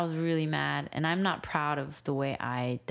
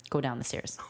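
restrained, low-key order; calm command; 3 semitones lower than usual. Retold or imitated. Dad sending his child downstairs, calmly. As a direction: order it with anger held in. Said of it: "all calmly".

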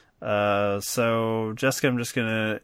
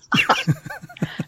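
high, breathless wheeze of a laugh; wheezing laugh; 4 dB louder than usual. Real, not acted. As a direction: let a squeaky silent laugh escape. Reacting to the Looper joke.

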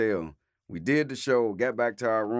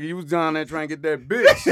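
laughing; 6 semitones higher, 7 dB louder than usual. Real, not acted. Teasing Mike, laughing.

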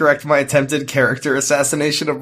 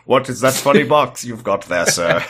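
put-on British actor voice; mock theatrical English delivery; Tom Baker; 4 semitones lower than usual. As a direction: play an eccentric English actor, hammed up. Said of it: "why is he Tom Baker?".

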